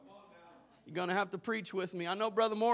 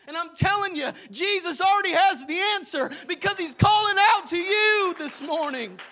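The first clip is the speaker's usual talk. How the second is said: rising, loud preacher's build, higher and louder; preaching at full force; 9 semitones higher, 7 dB louder than usual. Acted. Preacher rousing the Sunday congregation, building to a shout. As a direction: lift the congregation, pushing to a shout.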